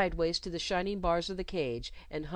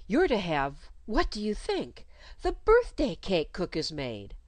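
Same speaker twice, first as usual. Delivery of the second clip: a touch brighter and louder, teasing surprise; 2 semitones higher, 4 dB louder than usual. Acted. Governess tempting the child with birthday cake, brighter. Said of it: "growing brighter".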